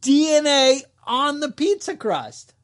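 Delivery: ordinary delivery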